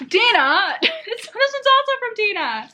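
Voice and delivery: bursting with glee; excited squeal. Real, not acted. Excited about gift from Dina.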